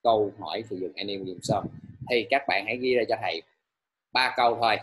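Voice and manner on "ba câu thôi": usual speaking voice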